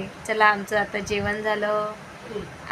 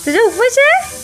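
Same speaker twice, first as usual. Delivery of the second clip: much higher, loud playful disbelief; mock surprise; 13 semitones higher, 5 dB louder than usual. Real, not acted. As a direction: act amazed at the little one. Mock surprise at toddler fasting.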